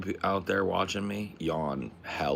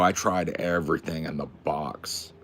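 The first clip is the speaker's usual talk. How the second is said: ordinary talk, nothing marked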